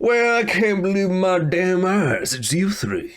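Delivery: a hint of the showman; lightly voiced swaggering hero. Alador addresses the guild hall, lightly voiced and grand. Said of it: "he goes".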